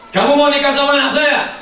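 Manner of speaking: high, loud demanding father; stern father. Acted. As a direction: bark the question like a strict dad. Father questioning suitor. (ayah galak bertanya keras)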